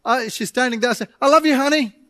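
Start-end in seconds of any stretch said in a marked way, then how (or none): 1.2-2.0 rushed careless goodbye. Retold or imitated. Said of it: "talking like that".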